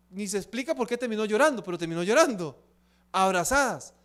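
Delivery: normal talking voice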